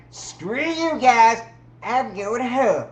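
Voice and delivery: high, loud and slow, mock furious; mock storming off. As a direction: storm off in a hammed-up huff. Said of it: "hate and screaming".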